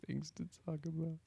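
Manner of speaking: high mocking echo through laughter; laughing mimicry. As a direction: laugh while parroting the host's line. Co-host laughingly mimics the intro line.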